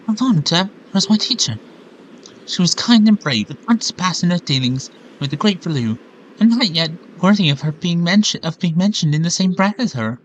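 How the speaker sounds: lightly voiced bird girl. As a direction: read the bird girl's lines with light admiration. Reads Medli's lines in voice.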